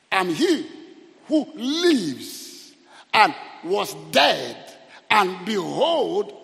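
loud, very slow declaration; proclaiming scripture. Retold or imitated. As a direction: stretch each phrase out with force. Proclaims the words of Jesus.

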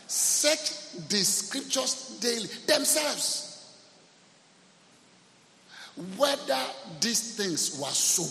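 slow, high, emphatic preaching; emphatic scripture reading. Real, not acted. Preacher driving a Bible verse home to the congregation, emphatic.

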